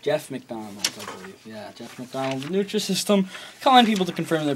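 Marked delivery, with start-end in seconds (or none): none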